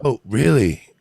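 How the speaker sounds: mock-shocked into the receiver; on the phone. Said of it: "I'm on the phone with my son-in-law".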